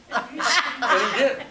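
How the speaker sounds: slow, loud and high with laughter; laughing. Real, not acted. Laughing as he tells the host the billionaire kept his word. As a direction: laugh out the punchline.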